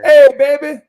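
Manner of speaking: high, loud sing-song greeting. Real, not acted. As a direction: coo loudly at the pet. Baby talk to dog.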